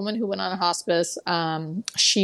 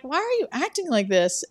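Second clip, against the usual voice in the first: higher, puzzled questioning; retold bafflement; 7 semitones higher than usual. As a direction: imitate a bewildered caregiver asking why. Caregiver questions woman's odd behaviour.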